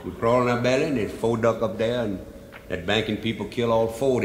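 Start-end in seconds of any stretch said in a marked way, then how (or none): none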